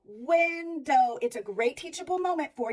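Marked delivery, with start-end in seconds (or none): 0.1-1.2 window drawn out slowly; slow recap. Shown as a demonstration. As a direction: say window slowly as a final model. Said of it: "recap of the word".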